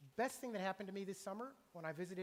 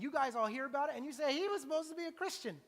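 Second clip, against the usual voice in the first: loud, higher, tutting judgment; mock scandalized gossip; 7 semitones higher, 8 dB louder than usual. Pastor imitating the church crowd's gossip, loud and scandalized. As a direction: voice the crowd's smug disapproval.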